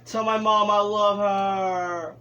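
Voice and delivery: slow, strained dying words; hammed-up last words; wounded soldier gasping. Caught in tornado, mock farewell.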